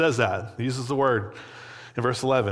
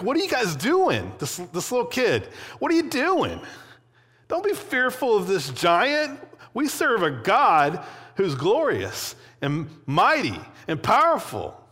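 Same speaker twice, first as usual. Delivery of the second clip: put-on much higher, incredulous boy's outcry; rally the scared army with a young voice gone high; a boy rebuking the frightened soldiers, outraged and much higher; indignant David; 9 semitones higher than usual. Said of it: "He's, like, angry about it".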